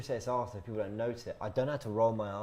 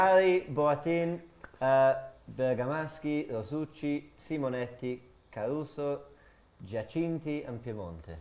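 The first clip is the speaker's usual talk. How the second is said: Italian names in a hammed-up Italian accent; best Italian accent. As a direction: read the lineup like a proud Italian. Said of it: "in my best Italian accent".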